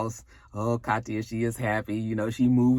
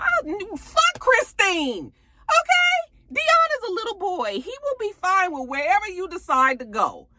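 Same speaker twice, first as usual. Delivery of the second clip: real exasperated outburst. Real, not acted. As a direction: blow up in exasperation, high. Speaker exasperated at Nate.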